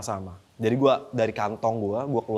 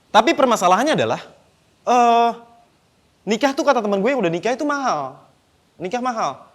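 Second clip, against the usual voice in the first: raised pitch; 8 semitones higher, 5 dB louder than usual. Real, not acted. Stand-up bit, pitch jumps high.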